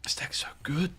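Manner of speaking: hushed secretive whisper. Real, not acted. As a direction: breathe it like a secret. Whispered aside about deck.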